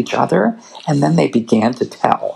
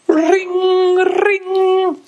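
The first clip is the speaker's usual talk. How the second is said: high sung telephone ring; phone ring. Acted. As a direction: imitate a ringing phone, high and long. Phone rings loudly.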